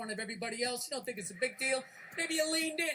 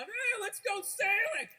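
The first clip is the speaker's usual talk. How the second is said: slow, higher, loud sailing cheer; mock cheery shout; 8 semitones higher, 4 dB louder, slower than usual. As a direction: shout it like a carefree sunburnt tourist.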